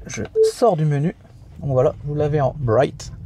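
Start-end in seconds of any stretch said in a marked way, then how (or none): 2.6-3.1 put-on American English accent. Shown as a demonstration. Reviewer showing viewers the light screen, in an American accent. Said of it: "Vous remarquerez mon super accent américain".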